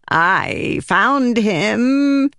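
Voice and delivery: high, slow, drawn-out proclamation; proclaiming spren. Acted. Pattern announcing his find, slow and high. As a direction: announce it like an odd spirit. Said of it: "he proclaimed".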